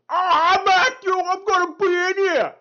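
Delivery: high, loud hammed-up Tracy Morgan yell; Tracy Morgan impression. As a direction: bellow it as a wild Tracy Morgan. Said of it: "Tracy Morgan's gonna be playing".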